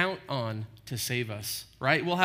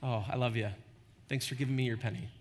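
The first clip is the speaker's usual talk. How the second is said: put-on much softer and lower, a parent thanking a small child; fond parent; 5 semitones lower, 8 dB quieter, faster than usual. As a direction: gently humor the kid with the penny. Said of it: "my child bringing me a penny".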